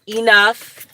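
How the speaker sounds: loud, firm command; fed-up real scolding; sharp yell. Real, not acted. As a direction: snap it at the dog. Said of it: "I'm sorry I did yell".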